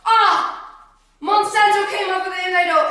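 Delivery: high, shouted prophecy with laughter in it; excited, full-voiced proclamation; loud declaiming. Re-declaims prophecy over the listener.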